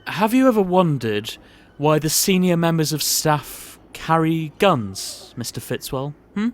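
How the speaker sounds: looming, menacing posh boss; sinister boss. Acted. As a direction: build dread as you reveal the secret. Boss reveals the dark secret.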